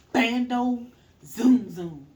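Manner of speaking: slow loud car noise; loud zoom zoom. Retold or imitated. Describing the stolen car, loud slow zoom noise. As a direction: belt out the zoom slowly.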